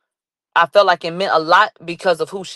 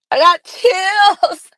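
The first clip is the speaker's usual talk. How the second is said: thrilled; 11 semitones higher, 3 dB louder, much slower than usual. Real, not acted.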